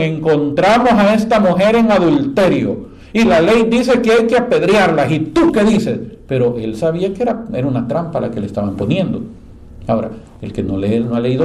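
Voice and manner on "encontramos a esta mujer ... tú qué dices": higher, louder accusers pressing Jesus; accusing challenge. Retold or imitated. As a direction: demand an answer, raising the voice.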